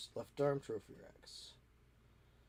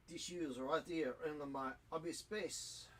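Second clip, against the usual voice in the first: slow, softer mock French accent; ridiculous French accent; 3 semitones higher, 7 dB quieter than usual. Modeller finding tissues in the hobby space, ridiculous French accent. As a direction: draw it out in a silly French accent. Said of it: "that is my ridiculous French accent".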